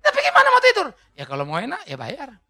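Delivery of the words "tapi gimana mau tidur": high, loud mock protest from the wife; whiny wife protest. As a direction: squeal it like a wife who cannot sleep. Wife's protest voiced in joke. (protes istri, tinggi dan keras, pura-pura merengek)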